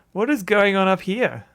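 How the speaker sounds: laughing disbelief. Real, not acted. Laughing at the game's oddity.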